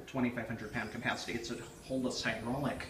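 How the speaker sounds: normal talking voice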